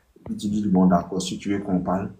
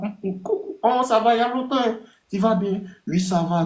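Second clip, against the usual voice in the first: put-on high cutesy girl voice saying coucou; imitating a girl; 12 semitones higher than usual. Advising a guy, he mimics the girl's text in a high girly voice. Acts both sides, very high.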